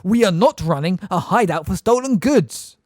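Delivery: a mother bellowing at the top of her lungs; shouting at the top of her voice. Acted. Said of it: "shouting at the top of her voice".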